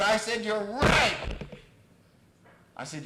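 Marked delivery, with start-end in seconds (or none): 0.4-1.2 loud, high retort; emphatic agreement. Retold or imitated. Therapist answering client's accusation, loud emphatic agreement. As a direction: agree at full volume, as a comeback.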